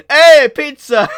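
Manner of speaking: Italian meme voice. Retold or imitated. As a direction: belt it like a pizza-ad Italian. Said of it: "Italian meme in the background going".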